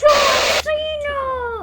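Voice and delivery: very high, very loud, slow screamed war cry; battle cry. Acted. Kid yelling a wrestler's name as a battle cry, slow scream. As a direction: scream it with everything you have.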